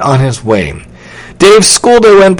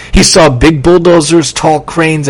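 usual speaking voice

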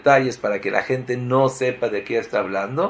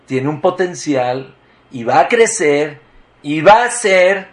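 building to loud and higher, slowing at the end; rising proclamation; 4 semitones higher, 9 dB louder than usual. Retold or imitated. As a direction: swell with conviction toward the last words. Rabbi proclaims the boy's future.

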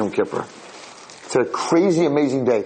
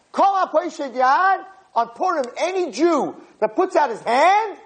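preaching; 11 semitones higher than usual. Acted.

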